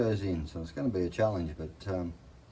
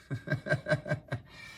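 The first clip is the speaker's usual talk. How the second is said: breathy laugh; 7 semitones higher, 4 dB quieter than usual. Real, not acted. Laugh at rusted patches.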